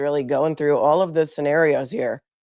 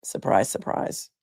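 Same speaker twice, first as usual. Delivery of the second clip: dry sarcasm; 9 semitones lower, 4 dB quieter, slower than usual. Real, not acted. Sarcastic aside.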